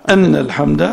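أن with an a vowel on the hamza; fatha on أن. Shown as a demonstration. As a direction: say أن as "anna", not "inna". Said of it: "وبفتحها".

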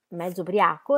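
usual speaking voice

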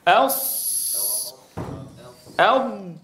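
teacher modelling emphatic letters; saad and daad demo. Shown as a demonstration. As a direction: draw out ص then ض heavy. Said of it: "يتجه صوتها نحو الأعلى".

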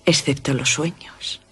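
slow, voiceless whisper. Acted. Carmila whispering to Laura about dreams, slow whisper. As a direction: breathe the words out slowly.

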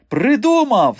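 high, loud eureka shout; elated. A narrator's reading. Man leaping off the bed to dance, elated. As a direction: burst out triumphantly with the idea. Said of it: "скочив Голий з ліжка і почав танцювати".

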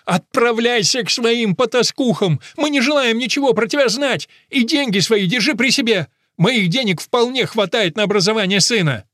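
high, loud, indignant roar; outraged woman at full pitch; enraged tirade. A narrator's reading. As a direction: drive him out with contempt. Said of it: "взревела мама".

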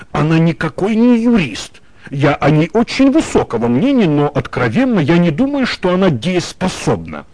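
ordinary delivery

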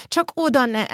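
a touch of despair, pitch lifted; mildly panicked inner thought. A narrator's reading. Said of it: "gondolta kétségbe esetten".

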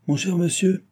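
high-pitched call to a customer; crying out. A narrator's reading. Postmaster hailing an angry traveller, crying out. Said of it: "cria le maître de poste".

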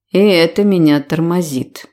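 plain reading voice

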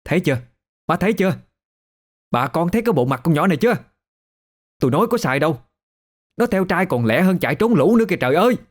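high-pitched, scandalized tirade; outraged village-woman complaint; indignant sister. Acted. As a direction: denounce her to the whole village, quick and high. Sister publicly denounces Lệ before villagers.

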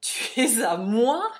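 loud, drawn-out incredulous question; thunderous disbelief; bursting out. A narrator's reading. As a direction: explode slowly on the words. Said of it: "éclata-t-il".

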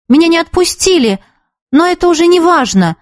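high-pitched, mildly hasty explanation; hurried reply. A narrator's reading. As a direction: brush it aside in a hurry. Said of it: "торопливо сказала Юлька".

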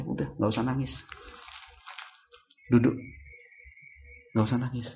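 soft comforting parent; acted soothing. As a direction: hush a crying child softly. Parent soothes a crying child. (menenangkan anak dengan lembut)

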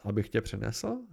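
ordinary delivery, nothing marked

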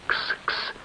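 hushed, voiceless cat call; soft call. A narrator's reading. Stranger calling the cat over, softly. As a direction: coax a cat very quietly. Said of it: "тихонько позвал незнакомец кошку".

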